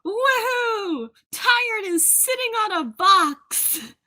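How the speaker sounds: high, loud whoop of delight; excited cheer. Real, not acted. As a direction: cheer like the team just scored. Player cheers as character responds.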